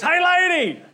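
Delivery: high, squeaky little frog calling out; muffled frog voice. Acted. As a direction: call up from inside a purse, small and high. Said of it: "you hear this muffled voice".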